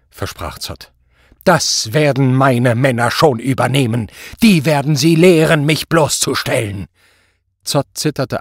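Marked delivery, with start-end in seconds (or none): 1.5-6.9 loud, raised, menacing; angry threat. A narrator's reading. Superior hands Zott to his men, loudly threatening. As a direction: pronounce punishment at full voice.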